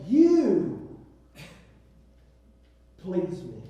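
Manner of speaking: soaring, drawn-out "You"; stress on You. Shown as a demonstration. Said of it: "There's an emphasis there".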